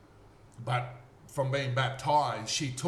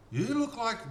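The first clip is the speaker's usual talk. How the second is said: much higher, startled half-sentence; astonished; 10 semitones higher than usual. Retold or imitated. Man staring at the stranger, astonished. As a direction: blurt it out in disbelief.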